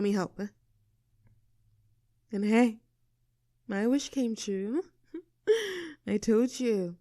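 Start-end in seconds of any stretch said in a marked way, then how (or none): 5.5-6.0 giggle. Acted. Happy laugh after wish.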